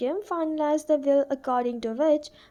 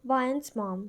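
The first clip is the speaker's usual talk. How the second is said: usual speaking voice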